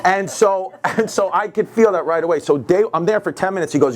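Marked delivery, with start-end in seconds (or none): none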